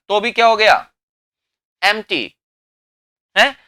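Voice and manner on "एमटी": "एम्प्टी" as two syllables, em-tee; silent p. Shown as a demonstration. Teacher showing it unchanged without p, p left silent. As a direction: say "एम्प्टी" as if no p were written. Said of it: "पी हटा दिया".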